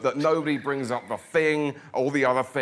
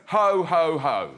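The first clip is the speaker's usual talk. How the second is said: louder first hos dropping away; mock tipsy Santa laugh; pissed-up uncle Santa; 4 semitones higher, 4 dB louder, slower than usual. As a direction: imitate an uncle styling it out. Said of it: "He sounds like a pissed-up uncle".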